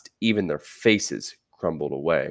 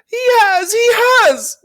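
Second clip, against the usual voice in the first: very high, loud, crying squeak; a baby squirrel crying out to the witch, very high and loud; squeaking little squirrel; 9 dB louder than usual. Acted. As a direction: blurt it out in panic. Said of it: "it squeaked".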